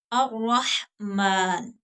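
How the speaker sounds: अर्रहमान slowly with doubled r, no l sound; assimilated lam. Shown as a demonstration. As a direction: say अर्रहमान as ar-rahman, lam hidden. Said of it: "मसलन". (अर्रहमान धीरे, लाम छुपाकर र पर ज़ोर)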